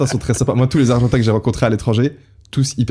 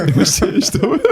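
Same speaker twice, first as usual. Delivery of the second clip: laughing; 11 semitones higher than usual. Real, not acted. Laughing over the landlord story.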